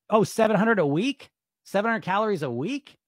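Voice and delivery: high, stunned double-take; suddenly surprised, questioning. Real, not acted. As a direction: catch the shocking number. Realizes comment says per week.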